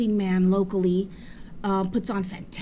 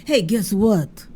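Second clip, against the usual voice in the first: slow, louder mock call from the liquor store owner; imitating Habib; 2 semitones lower, 6 dB louder, slower than usual. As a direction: draw it out like a teasing shopkeeper. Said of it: "he goes like this".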